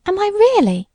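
high, eager, swooping question; light up with delight. A narrator's reading. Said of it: "in delight".

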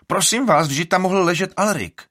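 loud, high indignant outburst; outraged. A narrator's reading. Vigo outraged that Alrik could have died. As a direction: burst out in disbelief. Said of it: "rozčilí se Vigo".

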